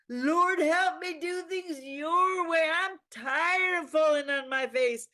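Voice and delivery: high, loud, tearful fervent preacher crying out, slow; a Southern preacher pleading with the Lord, half sobbing. Acted. A preacher in a Southern church begging God for help, crying out high and slow.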